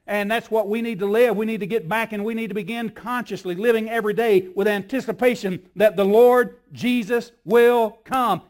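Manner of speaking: loud, rising sermon fervour; full-force preaching. Acted. Preacher rousing the congregation to anticipate, full force. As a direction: drive it home to the church.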